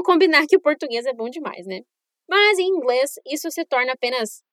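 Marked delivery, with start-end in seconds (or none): none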